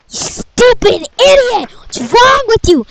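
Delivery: ordinary delivery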